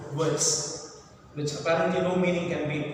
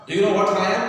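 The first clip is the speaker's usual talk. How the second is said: loud, acted demand from the convict; loud, stentorian voice; 6 dB louder than usual. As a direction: boom it out loudly. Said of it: "In a loud voice, in a stentorian voice".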